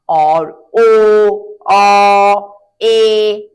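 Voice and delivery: ओ, औ, ए each stretched very slowly and called out loud; vowel sounds demo. Shown as a demonstration. Teacher calling out ओ, औ, ए to the class. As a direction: draw out ओ, then औ, then ए, loud and long. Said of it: "इन सब की मात्रा".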